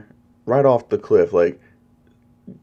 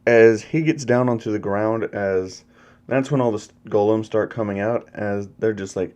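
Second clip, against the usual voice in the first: ordinary delivery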